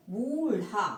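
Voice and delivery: बूढ़ा with a flapped ढ़, said as the right form; बूढ़ा said slowly with the dotted ढ़; correct pronunciation. Shown as a demonstration. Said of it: "सही शब्द क्या है?". (बूढ़ा का सही उच्चारण, ढ़ को उलटी जीभ से झटके से बोलें)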